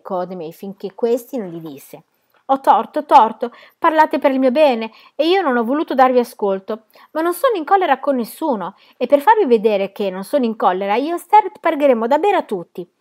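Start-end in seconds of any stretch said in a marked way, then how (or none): 2.5-12.9 high, loud conceding; urgent, raised-voice appeasing; loud surrender. A narrator's reading. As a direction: give in at full voice. Held brawler gives in loudly.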